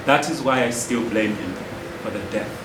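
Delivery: low, hushed grief; grieving blame. Acted. Father blaming his brother for his son's death, quiet grief.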